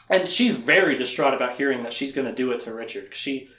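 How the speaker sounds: ordinary talk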